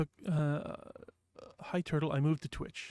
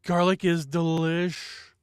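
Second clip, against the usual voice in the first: excited; 3 semitones higher, 7 dB louder, much slower than usual. Real, not acted. Savouring the garlic.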